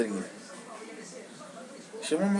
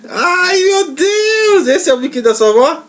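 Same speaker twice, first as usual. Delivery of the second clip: delighted shriek; 10 semitones higher, 4 dB louder than usual. Real, not acted. Mother reacts to baby's pout.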